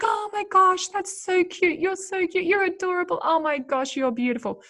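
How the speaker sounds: put-on baby talk. Cooing baby talk after the baby burps. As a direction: gush over the baby as if the burp were adorable. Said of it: "we're like".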